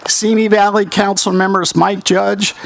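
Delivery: ordinary talk, nothing marked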